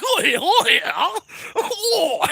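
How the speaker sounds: put-on very high English-accented caveman cries; hammed-up English caveman voice; mock British caveman. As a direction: hoot like a caveman with an English accent, half laughing. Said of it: "already have an English accent".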